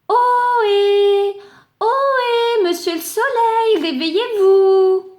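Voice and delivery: high, loud call up to the sky. Acted. Clod calling up to the sun, high and loud. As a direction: hail the sleeping sun from far below.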